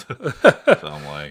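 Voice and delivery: words squeezed out through laughter; laughing. Real, not acted. Cohost laughing about the overlong first topic. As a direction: laugh while trying to speak. Laughing at running over an hour.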